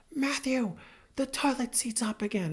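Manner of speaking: a light take on a wife's gentle reminder; mock gentle wife. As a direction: play the wife sweetly pointing it out. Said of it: "in a very gentle voice".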